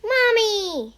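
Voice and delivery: loud excited call; breathless cry. Acted. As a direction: burst in calling out. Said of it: "he cried, out of breath".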